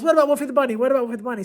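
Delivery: hammed-up insistent student nagging; imitating a pushy kid. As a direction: badger the teacher like an eager student. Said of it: "he just kept saying".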